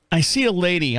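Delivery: normal talking voice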